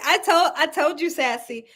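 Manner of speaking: ordinary talk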